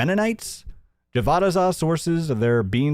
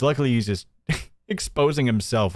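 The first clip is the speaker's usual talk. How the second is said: normal talking voice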